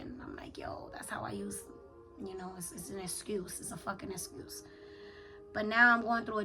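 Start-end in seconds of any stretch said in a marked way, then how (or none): 0.0-1.6 hushed confiding whisper. Retold or imitated. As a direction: breathe it out in a whisper. Whispered confession to spiritual guide.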